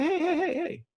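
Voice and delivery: high calls of hey to a passing car; calling out. Retold or imitated. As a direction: hail him down, voice up high.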